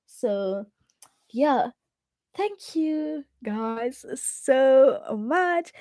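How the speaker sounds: ordinary talk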